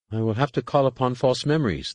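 a touch of a moan; mild pained moan. A narrator's reading. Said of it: "the Jedi moaned".

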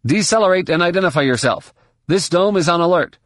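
high, brisk official radio order; comm controller. A narrator's reading. Dome control warning an incoming shuttle, brisk official voice. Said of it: "a male voice said".

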